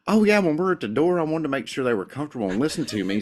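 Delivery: put-on lively, high-pitched East Tennessee accent; natural Southern accent. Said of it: "code switch back to my natural accent".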